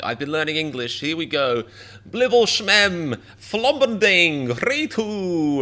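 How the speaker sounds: high, loud, slow fake-language babble; proudly spout made-up words; a hammed-up learner babbling nonsense; mock gibberish English. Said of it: "if R M was like".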